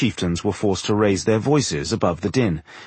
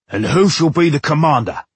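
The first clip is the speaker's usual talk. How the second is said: loud, high-pitched orc challenge; raised, forceful creature voice; demanding orc; 6 semitones higher, 5 dB louder than usual. A narrator's reading. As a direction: demand an answer as a brutish orc chieftain. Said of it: "demanded Kragnar".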